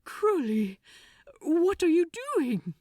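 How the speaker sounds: an angel frightened by his friend's red eyes; alarmed. Acted. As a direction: ask in rising fear.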